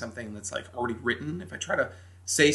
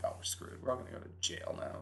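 scared whisper; 12 dB quieter than usual. Retold or imitated. Teens whisper fear of arrest.

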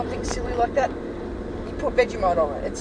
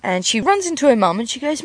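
ordinary delivery

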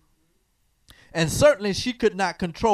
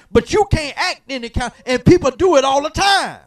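louder and higher, rising preacher's call; preaching at full force; 5 semitones higher, 9 dB louder than usual. Acted. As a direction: drive it home to the congregation.

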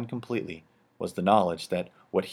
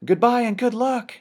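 put-on much higher, louder chorus of kids; children's voices; 7 dB louder than usual. A narrator's reading. The children waving their father off, high and loud. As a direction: cheer it like excited children. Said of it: "echoed the children".

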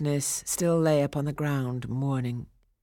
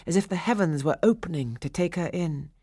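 plain reading voice

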